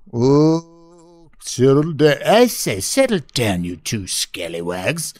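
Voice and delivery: hammed-up peacemaker breaking up a squabble; mock scolding. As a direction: playfully scold two quarrelling brothers. Acts someone scolding the two brothers.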